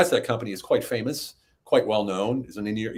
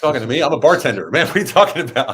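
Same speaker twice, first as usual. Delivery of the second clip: raised, louder mock disbelief; incredulous disbelief; 6 semitones higher, 8 dB louder than usual. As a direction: scoff as if the idea is absurd. Retold disbelief at job offer.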